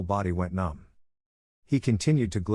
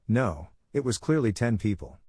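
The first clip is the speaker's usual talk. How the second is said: ordinary delivery, nothing marked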